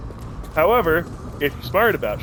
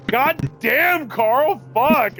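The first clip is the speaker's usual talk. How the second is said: high-pitched amazed exclamation, imitated; exclaiming younger brother; 9 semitones higher, much slower than usual. Younger brother in the crowd to his sibling, high imitated exclamation. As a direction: mimic a brother's astonished cry, pitched up. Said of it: "My younger brother in the crowd sees this and goes".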